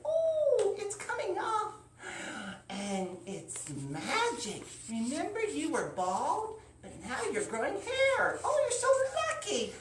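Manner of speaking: very high, marvelling; delighted amazement. Acted. Wife marvelling at the hair, very high. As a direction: gasp with wonder at the sight.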